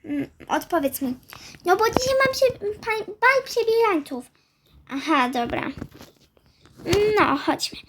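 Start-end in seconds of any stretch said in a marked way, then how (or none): 1.6-4.2 put-on high, whiny child explaining herself. Daughter explains her costume for the ball, high mild whine. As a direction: pitch it up and stretch it into a mild protest.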